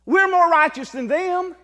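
preached boast. Acted. Preaching at full force.

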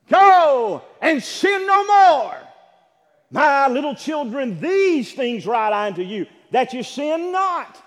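loud, high, slow preaching proclamation; forceful correction. Shown as a demonstration. Preacher corrects the misquote, loud and slow. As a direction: drive home the true words with full force. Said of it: "That's not what he said".

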